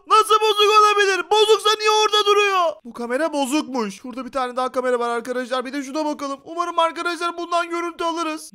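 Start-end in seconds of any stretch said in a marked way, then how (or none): 0.1-2.7 loud, high-pitched protest; outraged yelling. Pretend. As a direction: yell in hammed-up disbelief. Outraged at broken camera. (isyan ederek bağırma)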